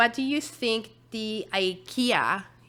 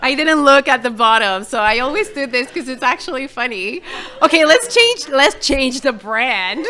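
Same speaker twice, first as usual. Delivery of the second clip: loud, higher, laugh-filled talk; cracking up, voice raised and loud; laughing while talking; 5 semitones higher, 11 dB louder than usual. Real, not acted. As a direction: talk through real laughter. Cracking up at own trick question.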